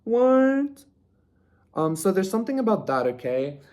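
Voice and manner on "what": high, loud mock shock; shocked people. Reader acting out people amazed he hears ghosts, high loud shock. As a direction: act out startled people, much higher and louder. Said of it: "and everybody's like".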